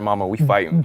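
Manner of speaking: usual speaking voice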